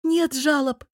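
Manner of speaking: glad, eager burst; joyful blurt. A narrator's reading. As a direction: blurt it out happily. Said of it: "радостно выпалила я".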